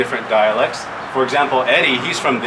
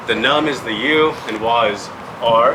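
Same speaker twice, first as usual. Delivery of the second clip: usual speaking voice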